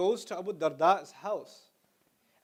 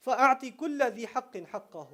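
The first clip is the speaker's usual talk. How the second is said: recited Arabic; 2 semitones higher, much faster than usual. Shown as a demonstration. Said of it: "recites hadith".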